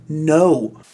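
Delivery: ordinary delivery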